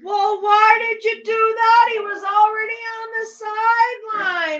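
high, loud yell at the player; exasperated fan hollering, pitched high; scolding shout. Real, not acted. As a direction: berate the player from the couch.

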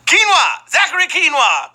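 high, loud joking call; playful shout. Pretend. Host mangles Zachary Quinto's name as a joke, shouting gleefully. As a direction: crow the silly name out high.

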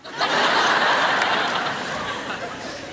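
unvoiced giggle; breathy laugh. Real, not acted. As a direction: laugh under the breath at the prank. Laughs at his planned prank.

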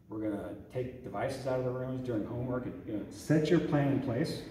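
ordinary talk

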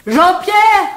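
high, loud call across the room. Acted. Hostess calling for Jean-Pierre, loud and high.

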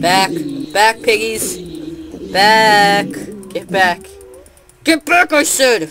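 loud commanding shout; shooing shout. Acted. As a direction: drive the piggies back. Shooing pigs away.

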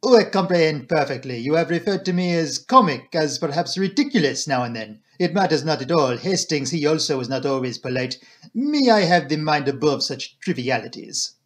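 put-on French-accented detective, grandly unbothered; self-assured Belgian detective voice; magnanimous Poirot. Detective waving away the doctor's apology, magnanimous.

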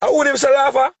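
high, insistent asking; excited high question. Real, not acted. Pressing a caller for a name, high and insistent.

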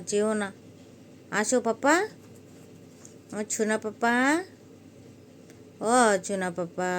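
loud cooing call to a pet cat; coaxing the cats. Real, not acted. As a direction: coax the kitten to come to you.

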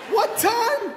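high, loud, laughing question; incredulous squeak. Acted. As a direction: squeak it out in disbelief.

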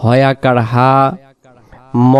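ordinary talk, nothing marked

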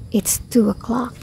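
soft, near-whispered remark; very quiet, breath-only voice; hushed aside. Real, not acted.

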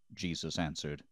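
usual speaking voice

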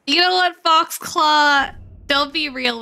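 loud mock indignation. Streamer answering Foxclaw's teasing, loud mock indignation. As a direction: burst out loudly at the teasing. Streamer snaps back at chat comment.

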